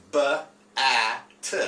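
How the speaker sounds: "b, a, t" split into three separate sounds with pauses between; sounding out phonemes. Shown as a demonstration. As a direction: segment the word sound by sound, like a reading teacher. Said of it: "we have the three different sounds".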